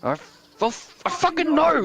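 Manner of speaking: high, sputtering mock tantrum outburst; little tantrum. Frustrated rehab patient snapping when told what to do, mock tantrum. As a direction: burst out loud like a frustrated patient. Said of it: "you have a little tantrum".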